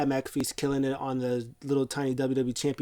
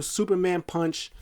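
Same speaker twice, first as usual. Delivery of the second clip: ordinary talk, nothing marked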